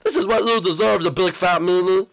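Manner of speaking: put-on kid-style shouting match; angry yelling. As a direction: yell it back at the bully. Toy quarrel, shouting back.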